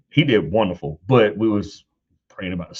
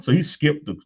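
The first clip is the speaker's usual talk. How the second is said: usual speaking voice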